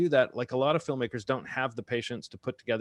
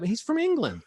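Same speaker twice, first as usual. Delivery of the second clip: amused disbelief, pitch leaping high; high-pitched astonishment with a laugh; can't believe it, squeak it out laughing; surprised; 12 semitones higher than usual. Real, not acted. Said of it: "I was really surprised by that".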